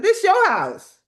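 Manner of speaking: high, loud, laughing burst; excited exclamation. Retold or imitated. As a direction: exclaim it gleefully, pitch leaping up. Excitedly retells telling her.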